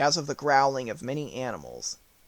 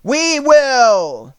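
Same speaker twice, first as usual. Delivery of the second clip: loud, higher crowd roar; roar of beasts; 10 semitones higher, 12 dB louder than usual. A narrator's reading. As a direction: shout it as a herd of beasts. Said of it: "roared with a mighty roar".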